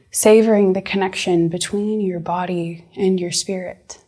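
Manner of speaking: slow, measured meditation voice with long pauses; guided meditation. Acted. Counseling intern leading you back to the present moment, guided meditation. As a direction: guide the listener gently, leaving space between phrases.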